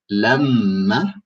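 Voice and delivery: LAMMA drawn out on a long m; double m. Shown as a demonstration. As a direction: hold the m in LAMMA as a double sound. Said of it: "is the right pronunciation".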